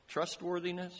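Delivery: usual speaking voice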